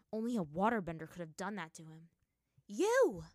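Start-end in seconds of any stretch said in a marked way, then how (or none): 2.7-3.2 sharp, high challenging shout. A narrator's reading. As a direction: shout it at him, loud and high. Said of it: "a feminine voice shouted at the man".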